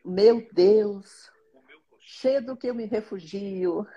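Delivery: slow, devout reading of a psalm; prayerful psalm reading. Acted. Mother reading her daily psalm to viewers, slow and devout. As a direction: read each verse slowly like a prayer.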